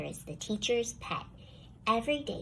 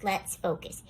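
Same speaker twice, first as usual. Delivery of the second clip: plain reading voice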